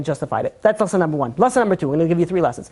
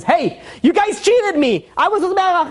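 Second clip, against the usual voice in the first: higher, slow mock outrage; indignant complaint; 11 semitones higher, slower than usual. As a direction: act wronged, drawn out and high. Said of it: "Yaakov Avinu comes in and says".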